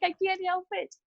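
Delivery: high-pitched playful plea of innocence; mock helpless protest. Woman retelling her excuse to everyone yelling at her, mock helpless. Retold protest at being yelled at.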